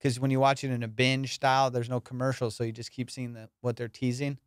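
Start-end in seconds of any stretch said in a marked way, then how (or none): none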